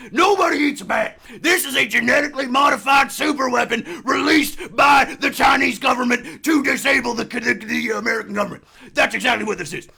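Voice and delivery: loud, high, raging conspiracy broadcaster; Alex Jones rant. Acted. Podcast host ranting about a bat virus conspiracy, shouting. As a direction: bellow paranoid theories at full force like a talk-radio host. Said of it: "I went a little Hogan on the end".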